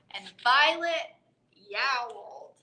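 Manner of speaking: ordinary delivery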